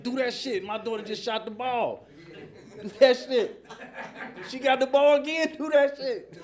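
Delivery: loud, high hype-man cheering at the game; sideline cheer. Retold or imitated. Uncle cheering his niece's basketball shots, loud and high. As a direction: yell it like the proudest uncle in the bleachers. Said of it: "that cheer still stuck in my head".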